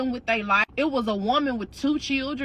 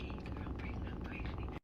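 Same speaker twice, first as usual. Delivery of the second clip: voiceless whisper; whispered confession; 19 dB quieter than usual. Retold or imitated. Telling her friend the secret at the funeral, whispering. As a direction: confide it under your breath.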